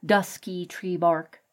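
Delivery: plain reading voice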